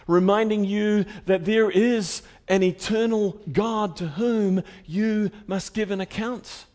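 usual speaking voice

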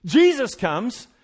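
loud, driving sermon build; fired-up preacher rising to a climax; preaching at full force. Acted. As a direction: hammer the point home from the pulpit.

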